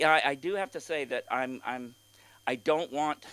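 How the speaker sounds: ordinary talk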